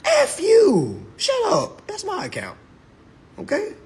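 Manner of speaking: high, sharp comeback; mock angry retort. Answering a nagging voice about his bank account.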